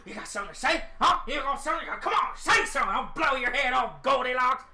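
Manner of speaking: menacing taunt that builds to a loud threat; threatening old farmer. Acted. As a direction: badger them with rising aggression. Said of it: "He's like".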